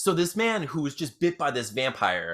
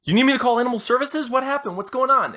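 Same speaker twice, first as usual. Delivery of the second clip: higher, urgent rapid questions; acted worried dispatcher, pitched up; alarmed dispatcher; 10 semitones higher, 4 dB louder than usual. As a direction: fire off questions in rising concern. Dispatcher reacts to frantic caller.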